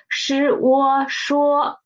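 是我说 slowly, initial and final joining into 说; drawn-out 是我说, piece by piece; put together. Shown as a demonstration. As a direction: blend 是, 我 into 说, stretched and level. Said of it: "So put them together".

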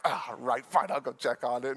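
low, weary giving in; mock grudging surrender. Husband giving in to wife, low and grudging. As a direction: imitate a reluctant husband relenting.

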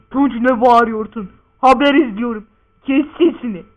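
loud, stern telling-off; scolding father. Acted. Father watching news scolds Tunç, angry and loud.

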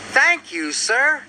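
high, eager little engine voice; pitched well up, bright storybook reply; Thomas. A narrator's reading. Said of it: "said Thomas".

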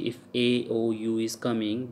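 normal talking voice

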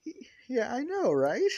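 high-pitched eager agreement; excited agreement. Acted. Toga agrees crazy is sexy, excitedly. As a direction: squeal it as an eager question.

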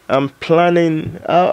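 ordinary talk

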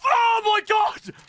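very high, loud, slow shriek; squealing oh my God at a sudden kill; shocked shout. Real, not acted. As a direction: scream it in disbelief.

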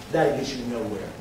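normal talking voice

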